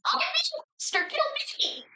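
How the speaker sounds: high-pitched strangled squeal; screaming puppet. Acted. Faded-paint puppet begs to be killed, high scream. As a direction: shriek it in a small falsetto puppet voice. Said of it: "faded paint screams".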